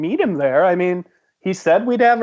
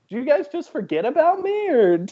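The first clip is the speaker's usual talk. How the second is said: high, put-out question; Mel; 8 semitones higher than usual. Acted. Mel to the friends who left her, high and put-out. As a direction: whine at friends who forgot you. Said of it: "And then Mel comes running up".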